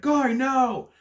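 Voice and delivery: high, loud mock yell; hammed-up movie outcry; acted warning shout. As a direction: cry out to stop him, retelling the scene. Acts out shouted warning in film.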